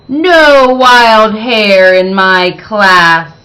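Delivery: loud, slow, strict order; stern teacher. Acted. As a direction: lay down the law to the class. Strict teacher forbids wild hair.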